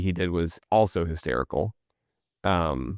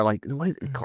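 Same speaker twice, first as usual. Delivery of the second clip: normal talking voice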